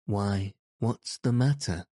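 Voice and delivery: plain reading voice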